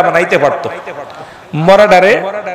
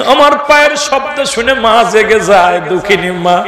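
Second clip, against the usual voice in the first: normal talking voice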